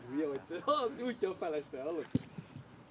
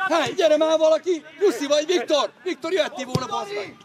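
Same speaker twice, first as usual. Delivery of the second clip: raised, high yell across the pitch; sideline shout; 7 semitones higher, 12 dB louder than usual. Real, not acted. Spectator scolding players for not coming, shouting. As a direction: holler for someone to come. Calling players during match.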